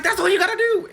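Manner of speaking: delighted outburst; excited exclamation. Retold or imitated. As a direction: shout it like you finally got through. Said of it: "And I was like".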